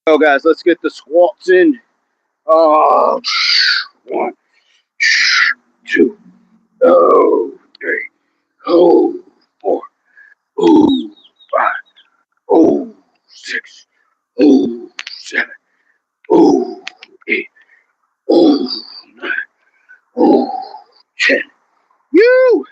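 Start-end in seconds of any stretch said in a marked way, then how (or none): 2.5-21.4 exerted counting. Real, not acted. Trainer counting out squats, puffing with effort. 22.1-22.7 high-pitched "whoo" of effort; high whoop. Real, not acted. Trainer finishing ten squats, high whoop.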